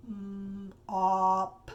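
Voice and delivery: choppy robot sounds, mm then op. Shown as a demonstration. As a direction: split the sounds like a mild robot. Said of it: "I'm gonna sound like a robot".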